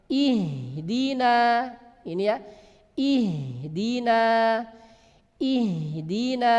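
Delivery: ihdina slowly with a light breathy h; correct ihdina. Shown as a demonstration. Teacher models correct Fatihah ihdina. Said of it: "kita contoh".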